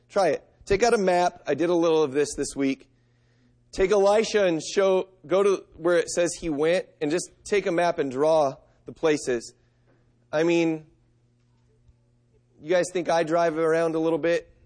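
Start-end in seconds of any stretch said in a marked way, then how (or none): none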